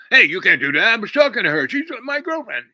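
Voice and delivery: high, loud whining outburst; indignant protest. Retold or imitated. Rival protesting to the guy talking to the girl, indignant. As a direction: protest loudly, voice climbing high.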